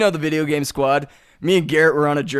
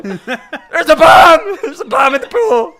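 hammed-up high, loud panic shout; mock panic; 7 semitones higher, 8 dB louder than usual. Mocking pool-goers scared by a floating package, panicked shouting. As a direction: yell it in mock alarm.

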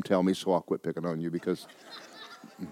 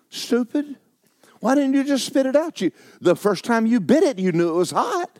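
much higher mock self-scolding; scolding himself; 3 dB louder than usual. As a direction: berate yourself like a fool. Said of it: "I was sitting there ... thinking".

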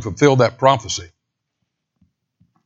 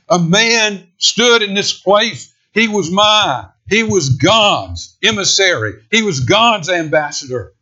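higher, loud condemning cry at Herod; voice of judgment; 8 semitones higher, 4 dB louder than usual. Acted. Jesus' silence condemning Herod, a loud high denunciation. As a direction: thunder the verdict at Herod.

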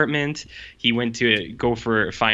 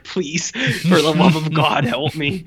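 mock pleading; 5 semitones higher, 3 dB louder, slower than usual. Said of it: "crying out".

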